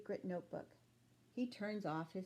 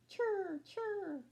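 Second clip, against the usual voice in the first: high, chirping cricket sound; 10 semitones higher than usual. Acted. Crickets chirping in the dark, high chirr.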